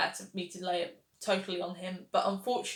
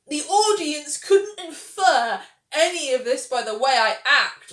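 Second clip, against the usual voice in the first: overdramatic TV character; 4 semitones higher, 8 dB louder than usual. Retold or imitated. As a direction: parody a character loudly dumping her whole backstory. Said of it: "that is how everyone talks in Ratched".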